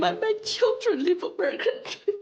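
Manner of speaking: crying. Real, not acted. Candidate tears up in ad.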